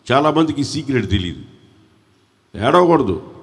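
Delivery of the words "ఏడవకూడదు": ordinary talk, nothing marked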